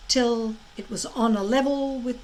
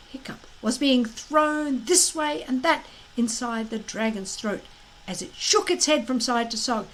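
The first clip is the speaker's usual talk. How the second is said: usual speaking voice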